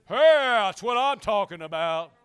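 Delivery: loud, slow burst of confidence; acted triumphant cheer. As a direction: holler it like a man now convinced. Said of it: "but by the end of it".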